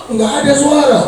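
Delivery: ordinary talk, nothing marked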